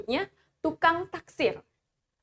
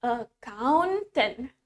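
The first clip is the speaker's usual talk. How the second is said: very slow, drawn-out "Accountant"; "Accountant" said slowly and carefully; slow model pronunciation; much slower than usual. Shown as a demonstration. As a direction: stretch "Accountant" so a class can join in. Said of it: "Let's pronounce it with me".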